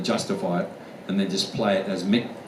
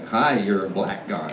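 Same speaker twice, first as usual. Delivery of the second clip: slow demonstration of an American accent. Shown as a demonstration. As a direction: say it like an American, unhurried.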